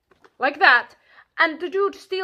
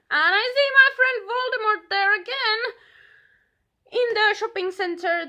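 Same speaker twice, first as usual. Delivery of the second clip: exasperated; 9 semitones higher, 4 dB louder than usual. Real, not acted. Exasperated retelling.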